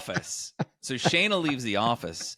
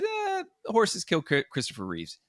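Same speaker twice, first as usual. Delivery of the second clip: mock earnest claim; 8 semitones higher than usual. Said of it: "And I said".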